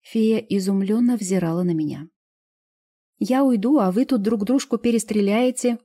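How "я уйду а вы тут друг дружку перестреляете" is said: stubborn. A narrator's reading. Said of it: "упрямо заявила она".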